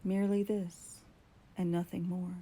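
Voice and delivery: ordinary delivery, nothing marked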